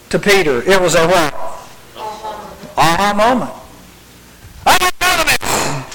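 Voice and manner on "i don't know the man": high-pitched, heated denial; very angry. Acted. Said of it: "being very angry to being very sad".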